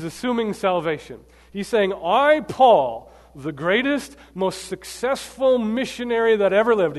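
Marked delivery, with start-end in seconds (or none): 1.9-7.0 grandly boastful Paul. Retold or imitated. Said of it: "he doesn't write that".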